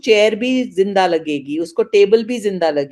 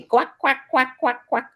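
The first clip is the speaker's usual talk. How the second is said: quacking duck; 4 semitones higher, 4 dB quieter than usual. Shown as a demonstration. Imitating a duck in story.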